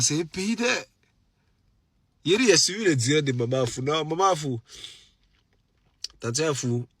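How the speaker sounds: ordinary talk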